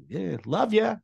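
high, breezy fake-friendly sign-off; fake cheesy. Mocking hosts' fake cheer. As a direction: toss it off like a hollow host. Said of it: "They were just so".

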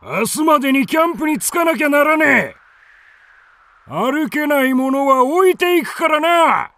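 高く大声で荒々しく怒鳴る; 捕らえた女たちを脅しつけるように叫べ; 怒鳴りつける頭目. Acted. 盗賊の頭が女たちを怒鳴りつける. (女たちを急き立てる盗賊の頭、荒い怒鳴り声)

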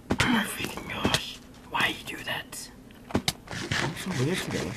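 slow breathy whisper to yourself; whisper, half embarrassed, slowly. Real, not acted. Embarrassed aside to self.

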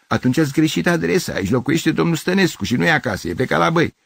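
high-pitched insistent neighbour; irritated correction. Acted. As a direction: set a mistaken stranger straight.

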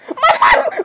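very high, stammered cry through laughter; laughing shriek. Acted. Speaker threatened over the drawing, laughing shriek. Stuttered outcry, laugh heard.

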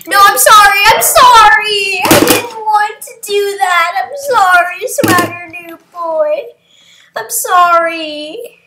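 over-the-top sorry, stretched and high; wailed apology. Real, not acted. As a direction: wail your apology to the other player. Apologizes to player after harming them.

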